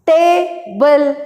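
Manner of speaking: "table" in two beats, short pause after ta; split into syllables. Shown as a demonstration. Teacher dividing the word for the class, "table" split ta-ble. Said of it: "we can divide this word".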